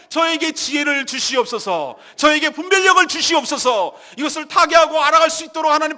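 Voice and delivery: loud, high crying prayer at full force; fervent preaching. Acted. Preacher leading the congregation in prayer, fervent loud preaching. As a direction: pour the prayer out at the top of your voice.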